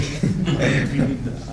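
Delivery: soft, breathy chuckle; amused chuckle. Real, not acted. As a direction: laugh quietly at your own story. Amused by prana's reply.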